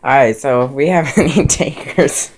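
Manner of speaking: talking through real giggles; loud laughter breaking the words; laughing. Real, not acted. Laughing at uncle's video.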